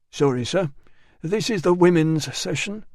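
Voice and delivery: politely block the doorway; mild elderly attendant apologizing; lightly voiced bath attendant.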